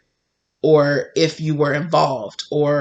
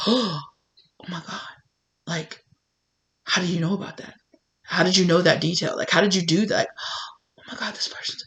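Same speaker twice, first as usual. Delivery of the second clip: breathless, softer gasped words; shocked whisper; 6 dB quieter, faster than usual. Acted. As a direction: hold it under your breath in horror. Horrified whisper at discovery.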